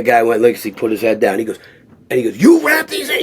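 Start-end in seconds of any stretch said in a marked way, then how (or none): 2.4-3.2 high mock yell at the trainer; hammed-up angry demand; imitating Stallone yelling. Director yelling at the trainer who wrapped hands, high mock yell. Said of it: "Stallone starts screaming".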